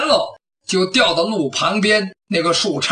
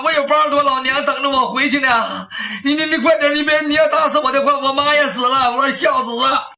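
begging thief; 6 semitones higher than usual. Acted. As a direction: howl for mercy like a cornered coward. Thief keeps begging in panic. (小贼高声哀求饶命)

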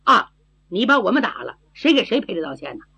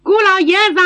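high, loud hail across a distance; calling from afar; 10 semitones higher, 5 dB louder, faster than usual. A narrator's reading. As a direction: call out so it carries to someone far off. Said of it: "金针虎刺儿在远处喊". (远远地高声呼喊)

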